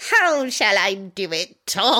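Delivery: prim Scottish-style teacher voice; hammy stern old witch; stern British witch. Retold or imitated. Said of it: "Professor McGonagall over here".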